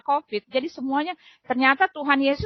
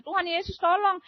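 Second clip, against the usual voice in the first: retold cry for help; 6 semitones higher, 3 dB quieter than usual. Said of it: "dia teriak-teriak".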